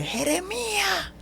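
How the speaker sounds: high, drawn-out booming call of the name; strong voice of God. Retold or imitated. Strong voice of God calling him in the bathroom, high and slow. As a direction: draw the name out like a heavenly summons. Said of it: "una voz fuerte que me dice".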